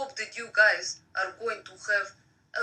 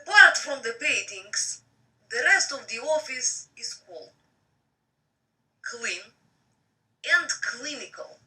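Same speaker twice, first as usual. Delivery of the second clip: reading a novel aloud; story narration; 5 semitones higher, 4 dB louder than usual. Acted.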